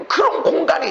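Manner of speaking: high, loud, rising sermon full of wonder; fervent preaching. Acted. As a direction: preach it with building joy and tears. Preaching at full force, rising excitement.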